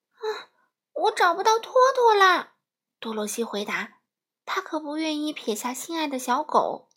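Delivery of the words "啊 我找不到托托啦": put-on high, loud, crying little-girl voice; tearful cry. A narrator's reading. Dorothy cries out that she has lost Toto, tearful. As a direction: wail it like a frightened child. Dorothy cannot find her dog.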